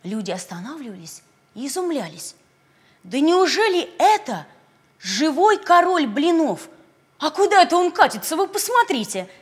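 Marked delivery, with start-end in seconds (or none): 3.0-9.3 excited amazed villagers. Acted. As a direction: gasp it out in wonder.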